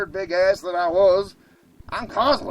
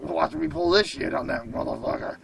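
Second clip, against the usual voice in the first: ordinary talk, nothing marked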